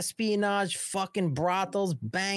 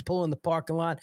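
ordinary talk, nothing marked

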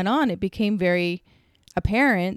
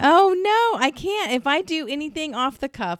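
real protest; 8 semitones higher than usual. Real, not acted. Refusing co-host's accent dare, in high-pitched protest.

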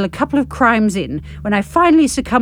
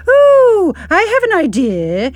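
much higher, louder bouncy presenter voice; children's TV presenter; 9 semitones higher, 5 dB louder, slower than usual. Acted. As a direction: sell the idea like a hyper kids' host. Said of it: "tones of one of those god-awful children's T V presenters".

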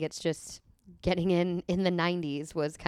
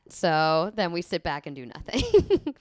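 ordinary talk

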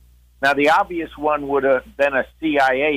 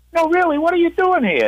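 much higher, insistent question; disbelieving. Retold or imitated. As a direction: press him in disbelief. Friend still disbelieving.

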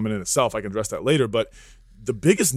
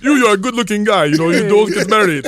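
put-on high, loud comic Indian-accented advice; mock Indian accent; 10 semitones higher, 11 dB louder than usual. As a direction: imitate a doctor with an exaggerated Indian accent.

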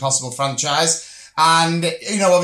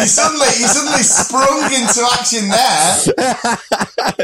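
laughing; 8 semitones higher, 4 dB louder than usual. Real, not acted. Laughing at co-host's sudden energy.

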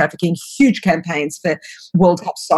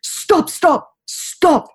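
put-on pleading "stop" in a much higher voice with a Russian accent; 11 semitones higher, much slower than usual. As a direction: retell the Russian girl begging, pitched way up, Russian accent. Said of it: "with a thick Russian accent".

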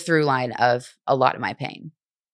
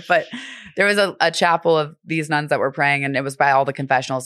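ordinary talk, nothing marked

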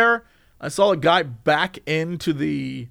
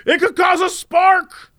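hammed-up frightened insisting; mock panic, much higher; panicked insistence; 10 semitones higher than usual. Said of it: "He's like".

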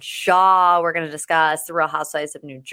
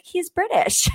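quick pitched-up aside, much higher; mock matter-of-fact quote; 11 semitones higher than usual. Host quoting herself on her fiance, pitched way up, playful. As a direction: toss it off high and light, like settling the matter. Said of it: "I'll be like".

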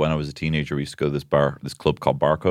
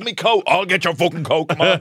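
irritated bouncer; 8 semitones higher than usual. Retold or imitated. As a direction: hurry them out, fed up. Bouncer annoyed over coats.

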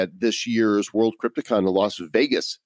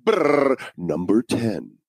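countdown announcer; slower than usual. Pretend. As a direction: announce it like a game show host. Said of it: "we're gonna do a top ten countdown".

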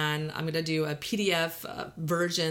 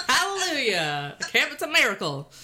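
laughing; 8 semitones higher, 5 dB louder than usual. Real, not acted. Echoing joyfully, laughing.

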